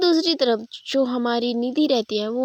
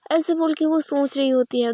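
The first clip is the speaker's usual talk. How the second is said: usual speaking voice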